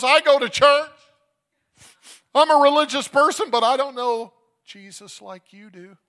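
loud, high acted wail; crying. Crying nurse confessing to the father, anguished wail. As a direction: cry it out, high and anguished, easing at the end. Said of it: "and she's crying".

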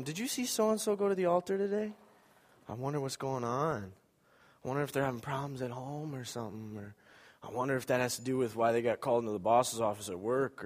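murmured, nosy church gossip; hushed gossip. Retold or imitated. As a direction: lean in and gossip under your breath.

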